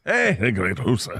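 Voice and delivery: slow mock Scottish, hoose for house; Scottish burr, hoose for house; Scottish accent. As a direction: roll out a hammed-up Scottish greeting. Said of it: "speaking with a Scottish accent".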